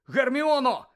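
high, loud cry of the name; alarmed cry. A narrator's reading. Ron rushing to hurt Hermione, alarmed shout.

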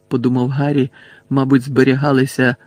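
ordinary delivery, nothing marked

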